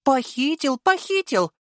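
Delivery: high, teasing sing-song insistence; sly. A narrator's reading. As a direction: confirm it with a sly smile. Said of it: "хитро улыбаясь".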